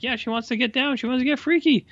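high mocking falsetto; teasing put-on voice. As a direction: tease her in a high put-on voice. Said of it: "I was like".

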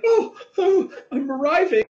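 high, laughing mock climax cry; mock orgasm. Teasing Alex, a high mock climax. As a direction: squeal it as a fake climax. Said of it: "I could be like".

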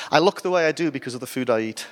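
normal talking voice

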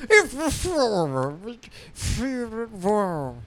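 high, slow garbled British blustering; Nigel Thornberry. Acted. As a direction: slur the words as a cartoon Brit. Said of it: "British Thornberry accent".

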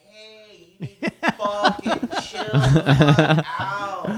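loud and slow, laughing through the warning; telling her off. Retold or imitated. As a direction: order her to chill, half laughing. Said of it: "So I get out and I'm like".

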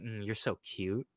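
cooing lover. Retold or imitated. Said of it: "And it's like".

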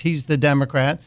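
ordinary delivery

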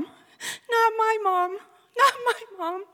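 slow, high sobbing plea; crying. Retold or imitated. Daughter sobbing after hearing her mom died. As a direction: break down sobbing the words. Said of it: "I begin yelling over and over again".

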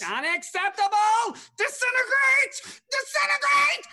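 high, loud raging shout; booming demon lord. Acted. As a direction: scream the command in fury. Xanathar raging at arena.

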